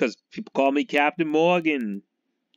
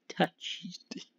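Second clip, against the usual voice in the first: breathy whisper of touched, much softer; a whispered punchline; whispered aside; 7 semitones higher, 10 dB quieter than usual. Real, not acted. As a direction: drop it to a secret whisper. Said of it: "John Matuzak was touched in the head".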